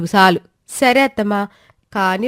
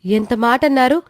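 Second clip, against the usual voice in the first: shocked outcry; 3 semitones higher, 7 dB louder than usual. Acted. Daughter-in-law offended by insult.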